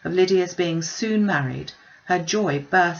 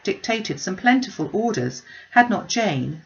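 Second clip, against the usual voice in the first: ordinary delivery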